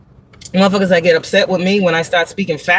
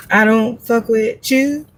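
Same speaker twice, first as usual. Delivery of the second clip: dismissive quote; 5 semitones higher, slower than usual. Retold or imitated. Acted quote of client's attitude.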